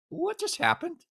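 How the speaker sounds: high, bewildered question; stunned disbelief. Retold or imitated. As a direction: ask it totally baffled. Family stunned in hospital room.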